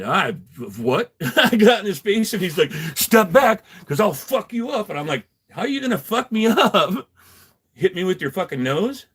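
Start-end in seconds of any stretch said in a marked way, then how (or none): 3.0-4.9 raised, high mock-aggressive voice; loud, high-pitched retold threat; imitated threat. 5.5-9.0 retold taunt.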